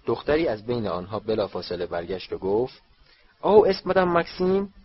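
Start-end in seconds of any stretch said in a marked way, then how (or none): 3.4-4.7 high, louder French-sounding question; French girl's voice. Acted. French girl looks for her headmistress, French accent. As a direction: ask it as a French schoolgirl.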